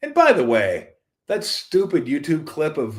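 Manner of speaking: normal talking voice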